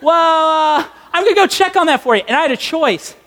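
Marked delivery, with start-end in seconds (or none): 0.0-2.2 high-pitched phone clerk impression; Kmart clerk. Store clerk helping a caller on the phone, high chirpy imitation. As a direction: play the clerk, pitched well up. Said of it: "she was like".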